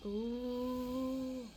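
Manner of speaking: normal talking voice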